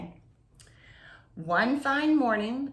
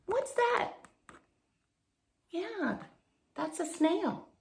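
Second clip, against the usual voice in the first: put-on childlike question, much higher; higher, soft asking voice; 9 semitones higher, 5 dB quieter than usual. Reader answering a child's question about the snail. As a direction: ask it like a curious little one.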